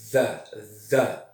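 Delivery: "the" with the tongue between the teeth and a buzz; "the" with a voiced TH, not D. Shown as a demonstration. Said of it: "A minha língua vai entre os dentes".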